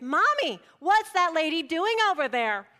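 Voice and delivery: put-on high, loud child calling out; loud kid's voice. Kids loudly asking mommy about the neighbor, a loud child's voice. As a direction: blurt it like a curious kid who won't whisper. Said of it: "in their not-so-quiet kid's voice".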